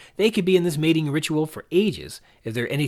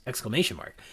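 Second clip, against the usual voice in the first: ordinary delivery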